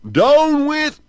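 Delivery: very high, loud squeak; man's shout turning froggy; changing voice. Acted. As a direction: let it squeak out as he turns into a frog. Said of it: "but it came out".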